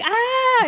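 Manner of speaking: high, loud exasperated "Ah!"; mock exasperated yell. The player hearing she went to the wrong building, a loud exasperated yell. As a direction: let out a comic groan of dismay. Player reacts to her mistake.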